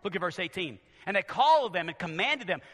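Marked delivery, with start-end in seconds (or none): none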